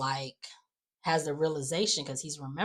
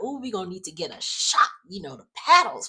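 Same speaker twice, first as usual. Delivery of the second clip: mock-dramatic, with a loud punch on key words; hammed-up dramatics; 4 semitones higher, 6 dB louder than usual. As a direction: play up the drama, imitating her. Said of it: "Leslie being all dramatic".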